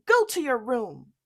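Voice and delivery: loud scolding command; mother sends Jack away, loud and angry; angry order. Acted. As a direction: send him off furious. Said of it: "said his mother".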